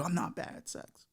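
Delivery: ordinary talk, nothing marked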